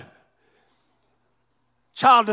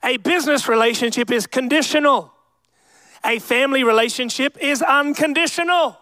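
ordinary delivery

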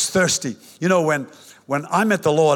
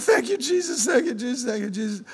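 high and fading to soft; overwhelmed thanks; 6 semitones higher, 5 dB quieter than usual. Retold or imitated. A new believer overwhelmed with thanks, fading. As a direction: start high and fade each thanks. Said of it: "And so I said".

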